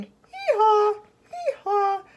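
high hee-haw like a donkey; playful donkey call; donkey bray. Acted. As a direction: bray it out, leaping up in pitch. Donkey character singing out its bray.

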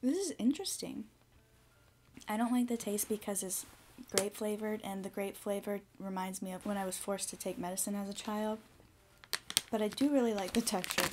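talking while chewing candy; chewy sweet in mouth; mouth partly full, chewing. Real, not acted. Seen 1: Bites gummy at -3; face shows chewing movements while talking after.

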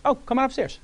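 high and casual, like a rich host; mock Barry. Barry inviting the young employee to lunch, breezy high voice.

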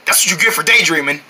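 normal talking voice

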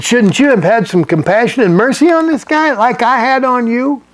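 a master scolding his servant for showing no mercy; master's rebuke. Acted. Master scolding the servant, rising indignant rebuke.

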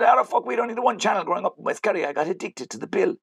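high-pitched, animated self-defence; mock protest. Fake cop excuses his accent, high-pitched mock protest.

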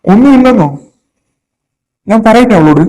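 loud, high, incredulous outburst. Acted. Father disbelieving his daughter's denial, angry and loud. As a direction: throw the denial back at full voice. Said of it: "ഒച്ചയൊന്ന് താഴ്ത്തി സംസാരിക്കേ".

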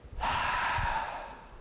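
slow, audible breath out; a soft released sigh; long exhale. Shown as a demonstration. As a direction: breathe it all out. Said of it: "inhale and exhale".

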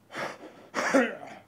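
coughing fit. Real, not acted. Coughing after swallowing yogurt.

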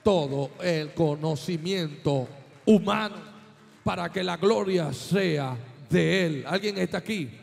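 preaching at full force. Acted. Preacher to the congregation, rising to a shouted climax.

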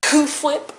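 "Whip" said as "hwip", with a puff of air; exaggerated H in Whip. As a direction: overdo the H in "Whip". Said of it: "so much emphasis on the H".

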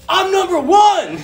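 high, loud, slow victory yell; triumphant shout. Retold or imitated. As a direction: bellow it to the whole restaurant. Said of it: "turns to the restaurant and goes".

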